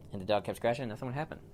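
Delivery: ordinary delivery, nothing marked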